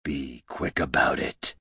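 slow, low, quiet command; deep, hushed villain; cold, hollow voice. A narrator's reading. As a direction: order your servant coldly from the shadows. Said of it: "said a cold, hollow voice".

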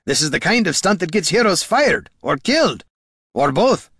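high, angry telling-off; barked reprimand. A narrator's reading. Dwarf warning the ranger heroes get fired, barking. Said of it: "barked Gorm".

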